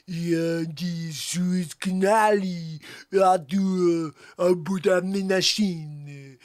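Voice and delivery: drawn-out, hammed-up French accent. Comic improvising French for viewers, mock French accent. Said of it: "French accent".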